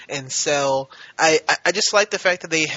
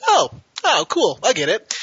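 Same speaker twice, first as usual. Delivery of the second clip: much higher and louder, quick; eager realization; 8 semitones higher, 5 dB louder than usual. Retold or imitated. Viewer who has seen enough shows, eagerly catching on. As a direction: suddenly get it, delighted. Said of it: "to be like".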